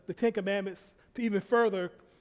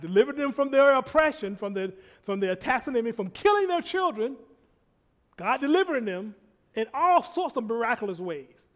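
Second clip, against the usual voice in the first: louder, higher preaching climax; full-force preaching; 5 semitones higher, 7 dB louder than usual. Acted. Preacher proclaiming God's deliverance to the congregation. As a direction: lift the church with it.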